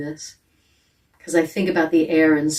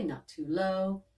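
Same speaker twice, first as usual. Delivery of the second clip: "not too low" dropped to a lower pitch, slow; 7 dB quieter, slower than usual. Shown as a demonstration. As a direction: sink "low" down slowly. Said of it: "an easy pitch".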